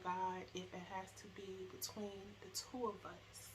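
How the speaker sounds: hushed retold prayer. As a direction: pray quietly to God. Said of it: "and saying".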